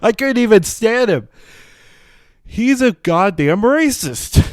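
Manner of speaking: loud, high-pitched exasperation; exasperated outburst. Real, not acted. Host can't stand Biden, loud exasperated outburst. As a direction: burst out loudly, stretching the words.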